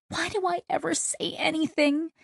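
high, soft whine; regretful moan. A narrator's reading. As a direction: moan it softly in self-reproach, pitched high. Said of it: "Kizzy moaned".

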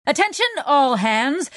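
high, self-important announcement; pompous. A narrator's reading. Crew member addresses everyone over the vox, pompous. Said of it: "Jenks said in a pompous voice".

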